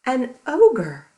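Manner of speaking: loud ogre reveal. Acted. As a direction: growl out the ogre for the kids. Dramatic reveal to children.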